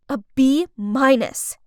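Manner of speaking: usual speaking voice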